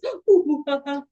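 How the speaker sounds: hooting monkey ooh-aah; monkey noise imitation. Shown as a demonstration. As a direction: make a playful monkey call. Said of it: "And it goes like this".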